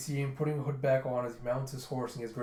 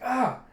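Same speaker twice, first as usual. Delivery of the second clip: high, loud "ah"; sudden cry of pain, acted; acted pained yelp; 11 semitones higher, 8 dB louder than usual. Girl burning hand on hot pot, pained yelp.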